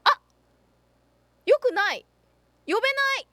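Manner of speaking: sudden panic at the bad news; yelping in shock; alarmed outburst. Real, not acted. Realizes she cannot summon.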